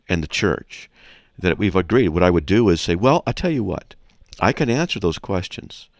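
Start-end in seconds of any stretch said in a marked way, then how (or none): none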